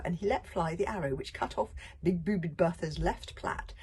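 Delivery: ordinary delivery, nothing marked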